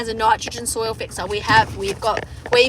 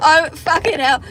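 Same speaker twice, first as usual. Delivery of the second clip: ordinary delivery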